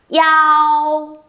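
"Yao" as one long high level glide from i into ao; linked vowels. Shown as a demonstration. Teacher models i and ao joined, linked "Yao". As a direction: hook i onto ao and sustain "Yao". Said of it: "Chúng mình đọc móc nối vào nào".

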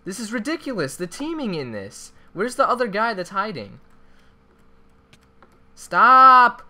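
high, loud angry shouting; frustrated yelling. Real, not acted. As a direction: yell at the teamers. Teamed up on, yelling.

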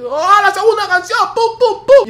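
very high, loud announcing yell; mock busker shout. Busker shouting his next song to the carriage, loud. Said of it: "se tranquiliza".

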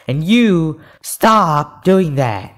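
high, loud, slow scolding; exasperated scolding. Real, not acted. Gamer telling another player off, exasperated.

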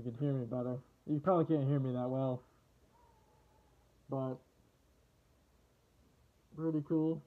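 muffled behind rubber mask; speaking through filter respirator; through a gas mask. Real, not acted. Seen 1: Full rubber gas mask with filter covers face throughout labelled words.

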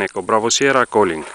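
usual speaking voice

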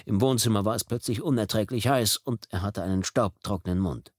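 ordinary delivery

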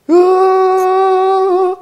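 sustained, loud falsetto wail; long high scream. Real, not acted. Player whose character loses his legs, long scream.

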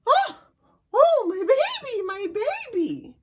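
very high, loud fretting mama; alarmed mother. Acted. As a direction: cry out like a worried mother. Mama rushes to baby.

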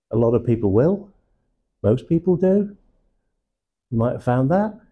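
each phrase ends upward, like an uncertain question; rising terminal. Shown as a demonstration. Trainer showing how uncertainty sounds, rising terminal on each phrase. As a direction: lift the pitch at the end of "will", "do" and "found that". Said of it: "that's a rising terminal".

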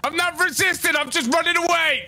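high, whiny panicked pleading; frantic protest. Acted. Tommy running from the cops while pleading his case, high frantic whine. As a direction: yelp it out while bolting from the cuffs.

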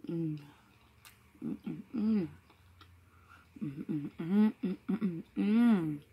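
talking with mouth full; mumbling while chewing; chewing food. Real, not acted. Seen 0: bit the wrap at -3; jaw and lips chew through the mms.

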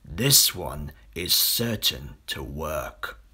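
low, slow sly crocodile voice; crocodile scheming. Acted. Crocodile plotting aloud.

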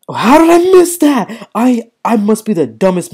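stretched-out outraged cry of self-disbelief; frustrated shout. Real, not acted. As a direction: yell it at yourself after a dumb miss. Gamer furious at his own miss.

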